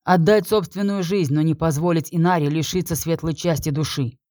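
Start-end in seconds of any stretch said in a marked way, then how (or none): none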